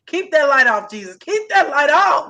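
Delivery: loud, drawn-out plea to keep the light off; pleading prayer. Real, not acted. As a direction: beg out loud for the light to stay off.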